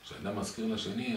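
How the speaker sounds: usual speaking voice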